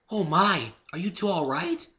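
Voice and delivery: gentle alarm at a tumble; worried Fluttershy. A narrator's reading. Fluttershy hurries over to check on them, high and worried.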